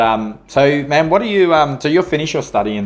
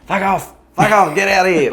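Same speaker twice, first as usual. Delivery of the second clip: hammed-up shout to shoo them off; parachuting bloke yelling at attacking kangaroos, mock shout; 10 semitones higher, 6 dB louder than usual. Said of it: "and he's like".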